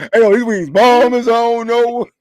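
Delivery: put-on loud, slow, laughing drunk voice; drunk laughing praise. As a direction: stretch it out like a tipsy friend. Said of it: "He was".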